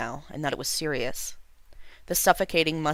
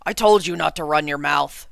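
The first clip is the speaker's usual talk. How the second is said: put-on louder, outraged old king; king scolding from the back room, louder and outraged; 8 dB louder than usual. A narrator's reading. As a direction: bark it from the back room in anger. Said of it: "An outraged voice came".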